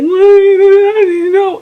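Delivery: high, loud, drawn-out wail; mock crying. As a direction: wail it as tears of frustration. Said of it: "I'm crying on the phone".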